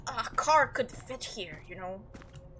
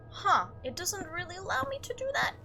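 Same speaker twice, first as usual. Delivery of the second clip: usual speaking voice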